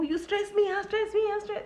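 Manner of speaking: mild mock yell at her sons; mock shouting mother. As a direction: play the stressed mother at her boys, lightly. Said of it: "She shouts every day".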